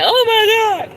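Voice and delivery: "Oh my God" shot up high and loud; surprised exclamation. Real, not acted. Vlogger reacting to a viewer's comment, loud astonished squeal. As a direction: gasp it out in disbelief.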